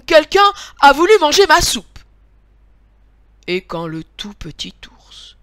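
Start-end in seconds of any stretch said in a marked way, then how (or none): 0.1-2.0 loud, raised, cross outcry; angry mama bear. A narrator's reading. Mama bear looks at her spoon, angry high voice. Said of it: "d'une voix fâchée".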